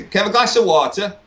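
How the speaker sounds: put-on water in broad English accent, no final r; strong English accent. Newly arrived guest ordering in US diner, strong English accent. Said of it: "I had a very strong accent".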